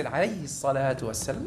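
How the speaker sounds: very soft, low murmur; murmured response. Shown as a demonstration. Preacher showing listeners what to say on hearing Muhammad, low murmur. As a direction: say the blessing low, under your breath.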